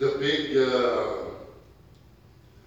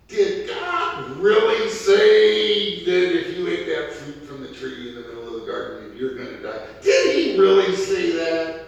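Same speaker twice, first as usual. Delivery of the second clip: tempting serpent; 6 semitones higher, 10 dB louder than usual. Acted. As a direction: coax doubt like the snake in the garden. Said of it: "He said".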